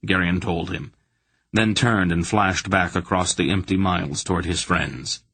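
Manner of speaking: plain reading voice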